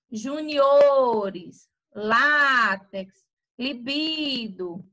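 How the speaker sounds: Juniores, látex, libido with O, LÁ, BI held long; penúltima sílaba forte. Shown as a demonstration. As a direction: punch and stretch the next-to-last syllable of Juniores, látex, libido. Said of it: "a penúltima vai ter o som mais forte". (Juniores, látex, libido com a penúltima forte e esticada)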